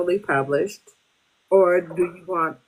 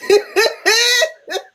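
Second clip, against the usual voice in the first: high embarrassed laugh; 6 dB louder than usual. Real, not acted. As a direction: laugh at yourself. Forgot her question.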